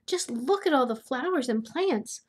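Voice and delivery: usual speaking voice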